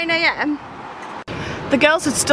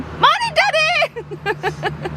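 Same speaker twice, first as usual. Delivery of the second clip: put-on much higher, sing-song child calling Morning, Daddy; cheery daughter; much slower than usual. Daughter answering the door in pyjamas, brightly much higher. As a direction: chirp it like a sleepy teen playing sweet.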